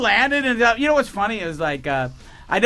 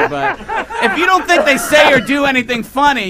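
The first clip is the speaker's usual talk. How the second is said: ordinary talk, nothing marked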